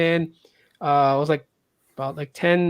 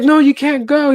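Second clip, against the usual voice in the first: higher, louder pleading to make him stay; desperate teacher; 9 semitones higher, 6 dB louder than usual. Retold or imitated. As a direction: grab him with your voice, begging. Teacher grabs guard's arm.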